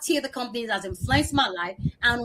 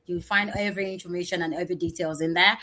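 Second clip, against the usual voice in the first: ordinary delivery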